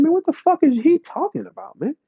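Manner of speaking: high put-on voice of disbelief; high incredulous mock voice. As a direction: squeak out a baffled what-the-fuck. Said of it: "I said".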